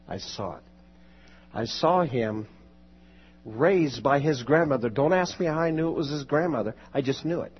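normal talking voice

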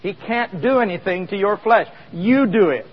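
usual speaking voice

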